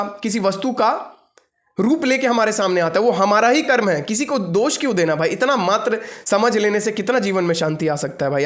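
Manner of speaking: ordinary delivery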